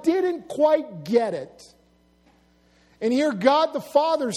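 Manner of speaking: usual speaking voice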